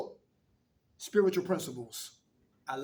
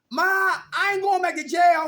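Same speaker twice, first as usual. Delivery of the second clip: higher, louder, slow insistence to his mother; retold loud promise; 10 semitones higher, 9 dB louder than usual. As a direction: swear it to Ma at full voice. Retells telling mother he's done.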